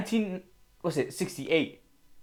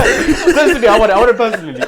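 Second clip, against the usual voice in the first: laughing; 7 semitones higher, 13 dB louder than usual. Real, not acted. Laughing while insisting.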